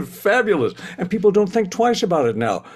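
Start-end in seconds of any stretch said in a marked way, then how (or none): 0.1-0.7 fabulous said through a high laugh. Real, not acted. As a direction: laugh the word out, delighted. Laughing at normality.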